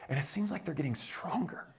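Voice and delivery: breathy whisper, as if sharing a secret; hushed whisper. Pretend. Preacher imagining the enemy's view, whispered. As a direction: lean in and whisper it.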